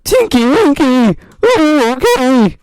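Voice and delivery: high, loud crying voice; high loud fearful cry to Tinky Winky. Acted. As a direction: call out high and scared. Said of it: "said in a fearful tone".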